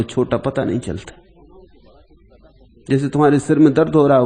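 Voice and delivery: normal talking voice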